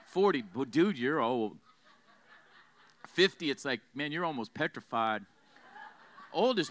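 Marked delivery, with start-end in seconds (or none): 0.5-1.6 low, slow student reaction; lightly voiced student, dragging it out low; college students. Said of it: "College students hear you're thirty, they're like". 3.9-5.2 low, soft student verdict; college students. Acted. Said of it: "College students hear you're thirty, they're like".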